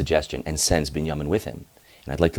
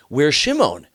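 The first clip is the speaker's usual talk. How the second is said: anxious father calling; 9 semitones higher, 5 dB louder, slower than usual. Acted. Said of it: "Yaakov's like".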